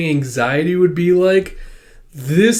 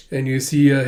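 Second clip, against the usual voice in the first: ordinary talk, nothing marked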